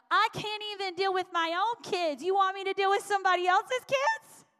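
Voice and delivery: high, loud disbelieving whine; a frazzled parent pushing back, voice way up; mock exasperated protest. Imagined overwhelmed parent protesting.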